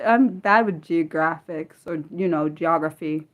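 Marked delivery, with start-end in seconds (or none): none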